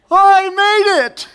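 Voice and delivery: high, slow and loud, a laughing shout of arrival; crying out in relief at the finish, laughing; triumphant cry. Pretend. Worshipper finally reaching point B shouts in triumph.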